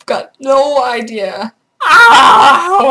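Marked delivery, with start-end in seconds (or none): 1.8-2.9 loud high cry of pain. Acted. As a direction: yelp as if hurt. Voicing hurt character.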